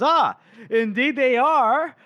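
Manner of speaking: high, drawn-out theatrical flourish; Nakomo Koska. Acted. Mercenary appearing behind the Duke, theatrically confirming. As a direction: play a slow, showy character making an entrance. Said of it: "we see Nakomo Koska".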